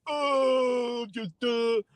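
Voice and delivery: high, drawn-out dopey wail; mock oblivious person. Mocking people who don't pay attention, dopey and high. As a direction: put on a high, clueless voice, dragging the vowel.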